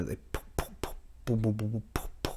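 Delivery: soft breathy poo poo pops then booms; popping engine sound. Shown as a demonstration. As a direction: mimic a cammed engine popping. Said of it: "it kinda sounds like a popping sound".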